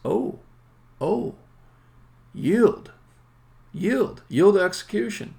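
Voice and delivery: high, loud exclamation with jumps in pitch; acted-out loud, high-pitched alarm; mock sudden realization. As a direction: cry out as if it just dawned on you. Acts out engine realizing time is up.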